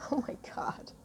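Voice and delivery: breathless whispered aside; awed whisper. Real, not acted. Overcome by the card's message, whispering. Overcome while reading card.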